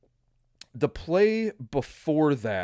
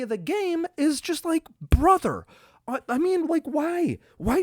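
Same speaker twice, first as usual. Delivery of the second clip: high, loud fed-up pleading; why are we doing this, brother; exasperated rant; 13 semitones higher, 4 dB louder than usual. Real, not acted. Fan ranting at the offense's trick plays, exasperated.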